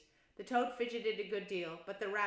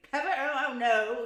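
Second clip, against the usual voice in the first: loud, preening mock-humble no; false modesty; 5 semitones higher, 12 dB louder than usual. A narrator's reading. Toad pretending to be modest, puffed up. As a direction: fish for praise. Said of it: "puffing and swelling already".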